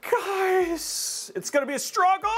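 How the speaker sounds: mock dramatic. Streamer pretending doom to chat, mock dramatic. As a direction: fake alarm to the audience. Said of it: "No, I'm just kidding".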